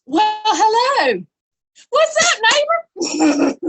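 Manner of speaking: high, loud, drawn-out hello; exuberant greeting. Real, not acted. Instructor hailing a neighbor who joins in, exuberant greeting. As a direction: sing out a big hello, stretched and high.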